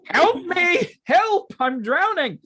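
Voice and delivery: high loud mock cries for help; mock drowning cries. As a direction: yell like you're going under, hammed up. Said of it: "You sound like you're drowning".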